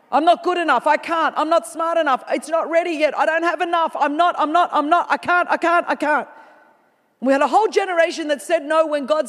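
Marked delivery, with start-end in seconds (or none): 0.1-6.3 voice of the lie. Pretend. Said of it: "we believed a lie".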